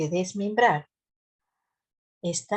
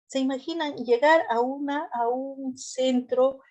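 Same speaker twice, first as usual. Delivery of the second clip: normal talking voice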